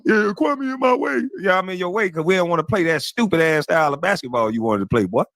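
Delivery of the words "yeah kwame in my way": higher mocking whine of a teammate telling on him; mocking whiny teammate. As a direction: mimic a tattling teammate, lightly whiny. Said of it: "told the coach".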